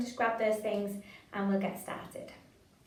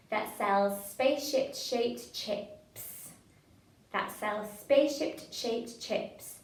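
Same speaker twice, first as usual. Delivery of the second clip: slow, careful "spaceship-shaped chips"; broken down slowly; much slower than usual. Shown as a demonstration. Leader breaks the hard twister into a slow half. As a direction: pick apart each sound slowly. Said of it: "I'm gonna break that one down".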